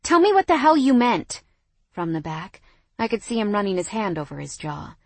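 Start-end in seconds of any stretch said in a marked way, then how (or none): 0.0-1.4 high, loud angry demand; furious. A narrator's reading. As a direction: demand an answer, incensed. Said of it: "furious as I peeled my dress".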